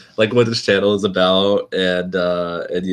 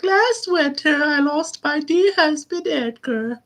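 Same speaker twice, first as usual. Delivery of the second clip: very high, slow put-on widow voice; hammed-up grieving widow; slower than usual. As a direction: play a mock mourner, high and drawn out. Acts out referenced widow dialogue.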